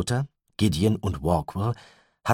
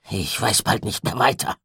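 rough voice; 5 semitones higher, 4 dB louder than usual. A narrator's reading. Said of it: "Gideons Stimme klang rau".

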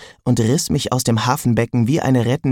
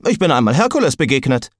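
boastful sword voice; 2 semitones higher, faster than usual. A narrator's reading.

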